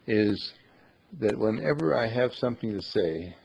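ordinary talk, nothing marked

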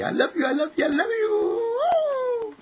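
very high, squeaky affection; gleeful squeal. Pretend. Playful hugging of Mommy and Daddy.

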